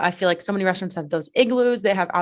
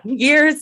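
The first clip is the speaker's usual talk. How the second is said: years punched loud and high; years hit hard, stressing the plural s; 8 semitones higher, 6 dB louder than usual. Shown as a demonstration. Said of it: "years is in plural".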